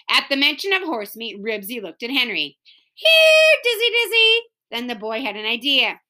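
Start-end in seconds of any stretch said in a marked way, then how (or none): 2.9-4.4 very high, loud opening yell; calling a dog. Acted. The boy competes for Dizzy, calling out. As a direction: shout for the dog in a high call.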